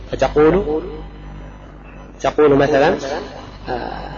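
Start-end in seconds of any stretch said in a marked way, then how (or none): none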